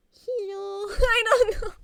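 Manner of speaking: high squeaky hello, breaking into laughter; silly voice. Shown as a demonstration. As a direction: play a goofy high-voiced character, giggling. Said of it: "try to make silly voice".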